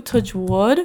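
ordinary delivery

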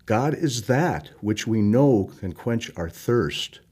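ordinary talk, nothing marked

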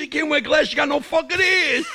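punchline blurted out loud and high, cracking up; laughing punchline. Acted. Comedian to podcast cohost, punchline laughed out loud. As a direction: shout the last line as you break into laughter.

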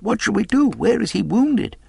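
very high, pleading questions; anxious cry. A narrator's reading. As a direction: plead frantically for guidance. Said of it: "we cried".

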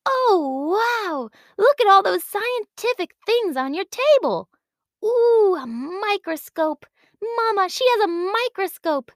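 put-on high, bright, amazed child voice; excited little girl. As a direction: gasp with wonder like a small girl. Girl sees science things, eyes wide.

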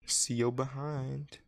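"See yo behind" stretched out slowly and high; slow, high teasing mock of the name; mock mispronunciation. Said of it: "Sounds like you're trying to say".

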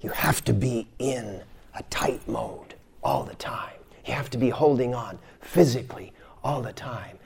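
breathy whisper, no voice. Acted. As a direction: whisper it to the class. Whispered teaching aside.

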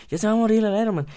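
high, loud, urgent hush; can't miss the big scene; excited moviegoer. Pretend. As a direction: boyfriend urging his date to wait for Iron Man's death. Boyfriend hushing during movie.

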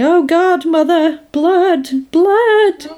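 high, drawn-out mock cry of horror; Norman Bates. Podcaster retelling Norman finding the blood, mock horrified cry. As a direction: cry out in mock horror, pitch climbing on each word. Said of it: "all you hear is Norman going".

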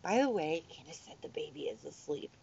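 hushed, fast whisper; quiet reminder. Retold or imitated. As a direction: tell them quietly the baby's asleep. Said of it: "She's like".

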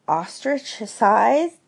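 puzzled Pooh. A narrator's reading. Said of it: "said Pooh".

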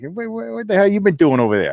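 lightly voiced Boston coach, high; a hint of Boston coach accent. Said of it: "he's a Boston guy".

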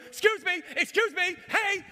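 high, loud shouts after someone; reenacted yelling. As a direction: yell to stop a man running away. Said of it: "I'm yelling at the top of my lungs".

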